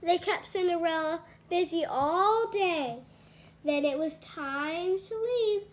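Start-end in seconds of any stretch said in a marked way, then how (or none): none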